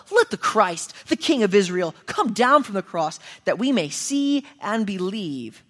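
loud sarcastic mockery; mocking taunt. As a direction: taunt him to come down. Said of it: "mocked him to one another".

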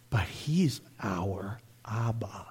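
soft, slow whisper. Real, not acted. Preacher telling the church God is their Father, whispered. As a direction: breathe the word Abba like a secret.

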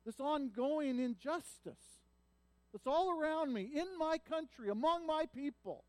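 high, loud anguished plea; anguished lament. Acted. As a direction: cry out in grief to God. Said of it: "then he goes".

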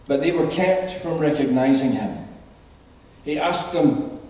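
measured public Bible reading, lifted and deliberate; scripture reading. Acted. Minister reading the Emmaus Road story to the congregation, scripture reading. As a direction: read it out clearly to a congregation, phrase by phrase. Reads the Bible passage aloud.

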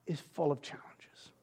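soft whisper; breathed, near tears. Real, not acted. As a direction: let it drop to a whisper. Emotional aside.

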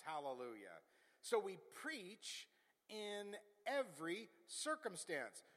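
bold, drawn-out pulpit declaration; emphatic, stretched-out proclamation; forceful preaching. Acted. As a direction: preach it out with conviction.

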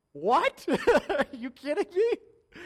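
loud, high disbelief, slow, with a laugh; shocked disbelief. Retold or imitated. Pastor retelling hearing of the triplets, loud laughing disbelief. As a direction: yelp it out like you can't believe the news, laughing.

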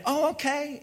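high, compliant mock-kid reply; agreeable child imitation. Child told to clean the room, high imitated willing reply. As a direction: pipe up as an obedient child.

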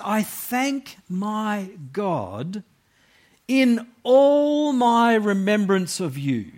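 slow, high, heartfelt reading; joyful tone. Shown as a demonstration. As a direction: read it with Paul's joy. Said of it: "you can't really miss the tone".

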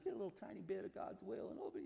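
quick, high, soft aside belittling the idea; dismissive mock. Preacher dismissing a too-small prayer, dismissive mock.

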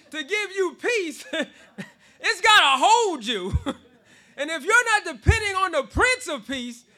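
loud, laughing preacher's cry; full-force preaching. Real, not acted. Preacher driving the point home to his congregation, loud and laughing. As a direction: preach it hard with joy.

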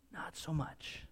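a dry whispered "Not so much"; whispered aside. Real, not acted. Preacher whispering a dry aside to the congregation. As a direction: drop to an ironic whisper.

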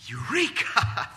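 loud, slow drawn-out cry of joy; joyful eureka. Real, not acted. Preacher exclaiming joy at children walking in the truth. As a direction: savour the discovery out loud. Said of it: "is literally the word eureka".

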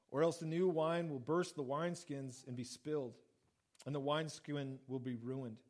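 measured Bible reading voice; scripture reading. Acted. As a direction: read the verses steadily.

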